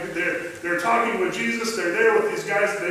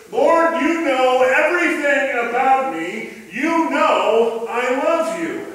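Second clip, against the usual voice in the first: louder, higher, blurting it out in exasperation; frustrated outburst; 5 semitones higher, 6 dB louder, slower than usual. Retold or imitated. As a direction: throw your hands up and blurt it, the last line slow. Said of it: "just completely frustrated".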